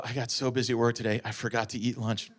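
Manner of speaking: soft, low and casual; understated ex-girlfriend imitation. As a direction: toss it off quietly, innocently. Ex-girlfriend imitated coming home from work.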